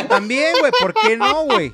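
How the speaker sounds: high and loud, egging on; excited. Real, not acted. Insisting in excitement.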